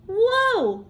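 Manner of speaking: high, loud amazed whoa; surprised whoa. Acted. Hoppy startled by the whirling egg, amazed whoa. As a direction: let the wonder burst out.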